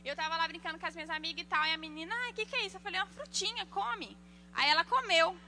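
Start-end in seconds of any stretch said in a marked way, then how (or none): 2.2-2.8 put-on high-pitched girl's question; curious little girl. Girl asking about the pepper, curious child voice. 3.0-4.2 higher child-like voice, playing innocent; coaxing child. Girl tricking her friend into eating pepper, coaxing.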